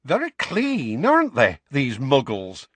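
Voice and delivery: put-on high-pitched, inquisitive young witch voice; curious young witch. Young witch looking around a Muggle kitchen, curious and high. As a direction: remark on it with lively curiosity.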